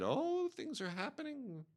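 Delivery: a high, unhurried old-lady tone, lightly imitated. Old lady friend quoted in story.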